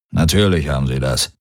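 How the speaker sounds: tief in sich hineingebrummt; Brummen. A narrator's reading. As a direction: brumme es tief vor dich hin. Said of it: "brummte Darayos in sich hinein". (tiefes Brummen)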